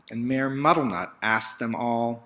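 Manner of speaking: ordinary delivery, nothing marked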